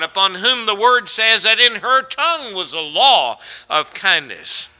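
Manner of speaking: loud, high preaching climb; rising to full-voiced preaching; fiery preaching. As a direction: build it up like a revival preacher.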